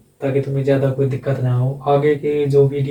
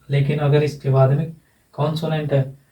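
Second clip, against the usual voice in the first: ordinary delivery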